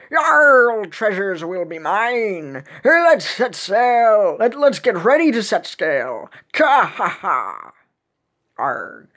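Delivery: slow, swaggering pirate boast; gloating pirate captain. Acted. Pirate captain readying crew to sail, gloating pirate voice. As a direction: crow it like a greedy pirate.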